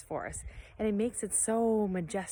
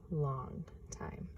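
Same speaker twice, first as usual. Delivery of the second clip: whisper; 6 semitones lower, 10 dB quieter than usual. A narrator's reading. Hushed narration, line ending.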